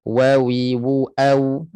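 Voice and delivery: Wawi, wu, au with pushed-out lips; Wawi, wu, au with rounded lips; rounded waw. Shown as a demonstration. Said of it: "muncung semua".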